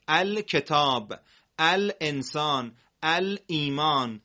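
الکتاب, الانسان, الایمان read slowly with the lam clearly sounded; drawn-out demo words; slow model reading. Shown as a demonstration. Teacher slowly models moon-letter words for students.

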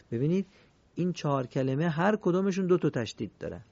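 ordinary delivery

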